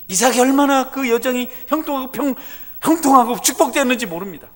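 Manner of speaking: high, loud, fervent sermon; full-force preaching. Acted. Pastor exhorting the congregation, loud fervent preaching. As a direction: preach it out to the rafters.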